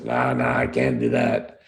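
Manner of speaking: dropping low and slow into his gravelly voice; gravelly GM impression. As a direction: growl the refusal like the old GM. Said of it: "You know how he had the gravelly voice?".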